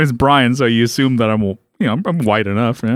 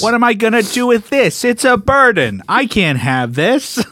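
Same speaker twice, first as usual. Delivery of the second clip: higher-pitched mock complaint; complaining woman; 6 semitones higher than usual. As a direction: whine like she's burdened by a gift. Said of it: "She just starts complaining more".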